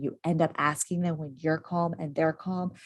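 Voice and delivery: usual speaking voice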